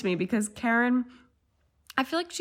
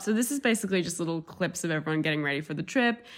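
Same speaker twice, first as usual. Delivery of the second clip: ordinary talk, nothing marked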